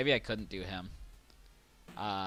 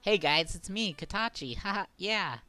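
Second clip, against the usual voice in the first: put-on high, sweet cute VTuber boy voice; chirpy little glass boy greeting the chat; 7 semitones higher than usual. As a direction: pitch it up sweet and cute for the fans. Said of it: "get a cute voice".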